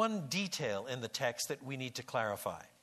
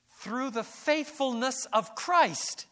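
much higher, louder and slow on through the faithfulness of Christ; stressing faithfulness; 9 semitones higher, 7 dB louder, slower than usual. Shown as a demonstration. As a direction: press faithfulness up high and slow. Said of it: "they read it this way".

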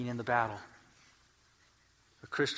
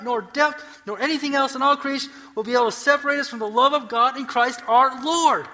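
loud, high proclamation of Scripture, building in force; impassioned preaching; 9 semitones higher, 10 dB louder than usual. Acted. Preacher declaiming a triumphant Bible passage to the congregation, impassioned. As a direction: preach each phrase emphatically, rising to a thundering close.

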